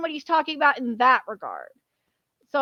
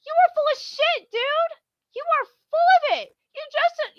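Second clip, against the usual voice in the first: exasperated outburst; 14 semitones higher than usual. Real, not acted. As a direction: call out his nonsense. Ranting at the localizer.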